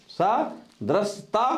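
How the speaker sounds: सदृशता said very slowly, each syllable apart, rising; slow dictation. Shown as a demonstration. Teacher spelling सदृशता slowly for pupils, careful syllables. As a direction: draw out सदृशता so they catch the दृ. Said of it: "ये दा है".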